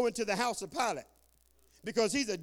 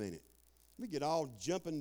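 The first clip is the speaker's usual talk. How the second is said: ordinary talk, nothing marked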